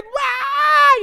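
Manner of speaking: loud, very high sustained wail; imitated child's wail. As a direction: cry out like a hurt little kid. Imitates little kid wailing.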